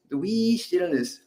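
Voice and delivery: a hint of a playful leprechaun, slow; lightly imitating a leprechaun lilt. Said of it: "a little playful leprechaun's voice".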